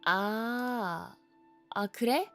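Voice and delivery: normal talking voice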